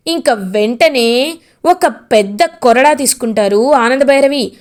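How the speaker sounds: ordinary delivery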